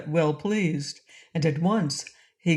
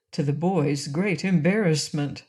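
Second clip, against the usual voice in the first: ordinary delivery, nothing marked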